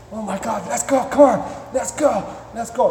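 high, urgent begging dog; acted impatient, giddy pet; excited dog's voice. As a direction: pester eagerly in a high dog voice. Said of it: "my dog is still going".

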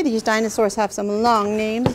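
ordinary talk, nothing marked

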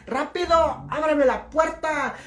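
high, drawn-out call to open up; acted urgent shout. Hunter chased by a lion to passengers, urgent shout. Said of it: "grita de afuera".

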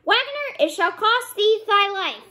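very high call, then a high threat; sparrow's threatening cry. A narrator's reading. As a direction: cry out vengeance in a high pitch. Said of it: "and cried".

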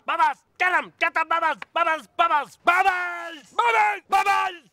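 high, loud calling; excited shouting. Acted. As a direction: shout it out eagerly. Calling out to a pet.

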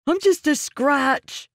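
high, slow, frightened plea; pleading. A narrator's reading. Prisoner insisting he is just a hired local, high scared plea. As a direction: beg them to believe you're nobody.

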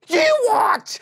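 very high, loud screech of disbelief; cat's furious squawk; outraged cat. Acted. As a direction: explode in an affronted shriek. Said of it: "And the cat said".

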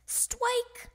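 put-on high childish voice with w for r; high, lisping little-kid cheer; little girl's yell. A narrator's reading. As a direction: yell "stwike" like a small child. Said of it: "yelled Polly".

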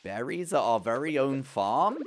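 slow singsong wonder, ending on a rise; playful exclamation. Pretend. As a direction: stretch it out with a big rise at the end. First take before farmer accent.

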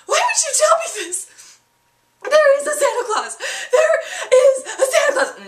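high, loud, half-laughing fake sob; mock wailing kid. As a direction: wail like a heartbroken child. Girl hears no Santa.

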